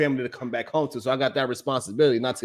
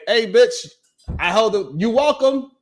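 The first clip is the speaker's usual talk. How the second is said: mock demanding; 7 semitones higher, 5 dB louder, slower than usual. Mocking a pushy man demanding thanks.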